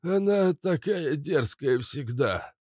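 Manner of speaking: put-on high, slow, hoarse old performer. A narrator's reading. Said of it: "сказал охрипшим голосом принц". (охрипшим голосом, протяжно)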